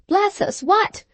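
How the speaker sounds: put-on high, startled old lady's cry; old lady startled by the boy's solemn manner; alarmed exclamation. A narrator's reading. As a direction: jump up in pitch with alarm. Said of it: "exclaimed the old lady, alarmed".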